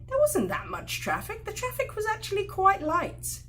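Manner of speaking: a primly corrective wife; contradicting. Retold or imitated. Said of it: "And she's saying".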